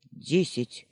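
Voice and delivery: short, gruff grunt of an offer. A narrator's reading. Baker names his counter-offer, grunted. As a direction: grunt it grudgingly. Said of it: "буркнул пекарь".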